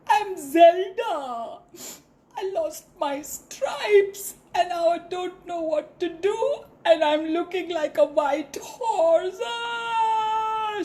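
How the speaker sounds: high, teary, wailing plea; crying zebra. Acted. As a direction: sob out the story.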